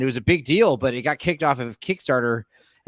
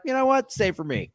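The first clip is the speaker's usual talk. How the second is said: high viewer voice; 12 semitones higher than usual. Retold or imitated. Imitating a viewer subscribing.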